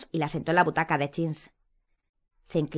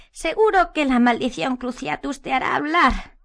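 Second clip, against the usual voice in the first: Umbridge voice; 8 semitones higher, 5 dB louder, slower than usual. A narrator's reading. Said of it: "con voz queda".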